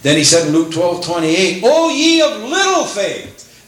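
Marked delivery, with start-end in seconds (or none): 1.7-3.7 plaintive lament. Retold or imitated. Jesus's lament voiced high.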